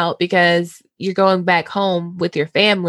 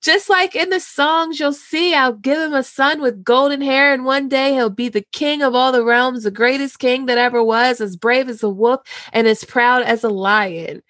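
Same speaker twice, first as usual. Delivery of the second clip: put-on higher girlish voice, lovestruck; dreamy Sansa; 5 semitones higher than usual. Host as Sansa dreaming of Joffrey aloud, high voice. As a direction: gush about the golden prince. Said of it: "And Sansa's like".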